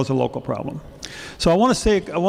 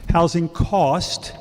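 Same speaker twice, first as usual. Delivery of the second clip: "Housing cost" said slowly and deliberately, each word set apart; term spelled out slowly; 3 semitones higher, much slower than usual. Shown as a demonstration. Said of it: "a couple of vocabulary things".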